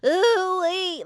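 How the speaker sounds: high ooh-wee with a cracky flip; flipping voice. Shown as a demonstration. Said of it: "some flips in his voice".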